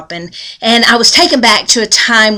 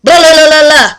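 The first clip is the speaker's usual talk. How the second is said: loud high blah blah blah; imitating gossipy shouting; mock hollering; 6 semitones higher, 9 dB louder than usual. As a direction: ham up people shouting nonsense. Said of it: "we go off hollering".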